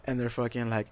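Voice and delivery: ordinary talk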